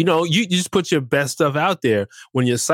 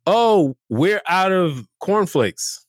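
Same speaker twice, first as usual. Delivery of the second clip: slow, drawn-out complaining guest; entitled guest; 3 semitones higher, much slower than usual. Retold or imitated. Guest complaining to the host, slow and entitled. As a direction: whine it like you own the place. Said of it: "they're like".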